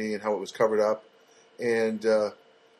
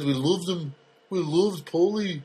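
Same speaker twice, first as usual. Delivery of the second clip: lightly put-on Liverpool accent, slow at the end; a mild mock Liverpudlian drawl; a hint of a fake Liverpool accent; 4 semitones higher, slower than usual. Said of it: "He was talking like this".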